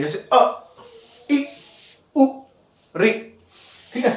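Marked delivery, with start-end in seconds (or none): 0.3-0.4 अ said very short, cut off at once; a quick, brief अ; short vowel. Shown as a demonstration. Teacher showing students a vowel that takes little time, short vowel. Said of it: "जो उच्चारण में कम समय लगे". 1.3-3.1 इ, उ, रि each very short, with pauses between; brief isolated इ, उ, रि; short vowels. Shown as a demonstration. Teacher giving examples of short vowels, each said short. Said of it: "जो उच्चारण में कम समय लगे".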